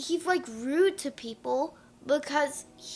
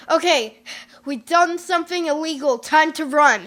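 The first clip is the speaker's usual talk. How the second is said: mock executive; 8 dB louder than usual. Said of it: "Did they, like, say".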